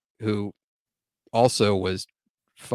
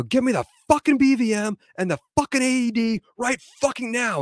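high-pitched, exasperated yelling order; urgent yell, pitch pushed high without full volume; retold yell; 13 semitones higher than usual. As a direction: re-enact yelling at a trainee, voice way up. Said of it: "and then I yell at him".

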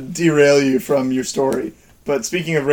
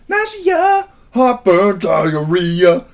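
squeaky high jokey pitch; silly ad voice; 6 semitones higher, much slower than usual. Pretend. Mocking a Pepto-Bismol ad, jumping up high. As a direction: ham up the ad list.

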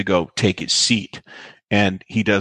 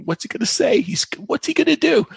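much higher, eager nudging question; buzzing with anticipation, pitched up; eager gossip. Retold or imitated. Eager whisper to Tommy.

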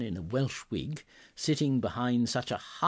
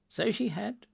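high-pitched, earnest exclamation; old man's heartfelt high cry; Scrooge crying out; 10 semitones higher than usual. A narrator's reading. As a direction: agree in a high, emotional voice. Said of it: "cried Scrooge".

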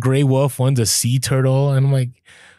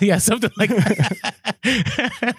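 laughing; 8 semitones higher, much slower than usual. Real, not acted. Laughing at co-host's impression.

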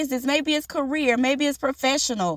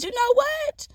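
much higher, softer, wondering; light revelation; 11 semitones higher, 3 dB quieter than usual. Retold or imitated. Host deciding to take the guest's words to heart. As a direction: say it as a dawning realization. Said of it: "But no, I said".

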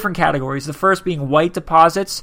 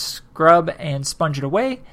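ordinary talk

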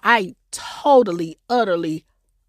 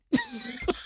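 high breathy laugh; 11 dB quieter than usual. Real, not acted. Laughing after coughing fit.

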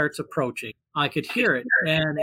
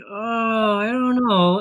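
higher-pitched, hesitant hedging; mock-reluctant retold reply, pitched up; 7 semitones higher, slower than usual. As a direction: drag your feet, imitating your younger self. Reluctant reply to being pushed.

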